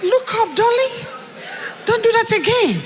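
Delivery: high, lilting imitation of her look up; imitating the speaker. Host imitating the speaker to the crowd, high and lilting. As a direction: echo her line back with a light lilt. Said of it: "You see how she said it".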